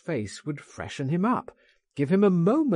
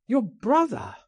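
high, louder startled question; surprised cry; 12 semitones higher, 4 dB louder than usual. A narrator's reading. Narrator startled by talk of the brother, high surprised cry. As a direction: recoil in surprise at the name. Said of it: "I cried".

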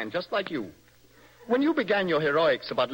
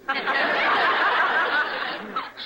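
loud high laugh; 12 semitones higher, 4 dB louder than usual. Acted. Laughs at rival's question.

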